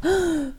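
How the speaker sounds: sharp startled intake of breath; surprised gasp. A narrator's reading. Devil girl sees the fire, gasping in surprise. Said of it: "Vor Überraschung reißt Luzifer die Augen auf".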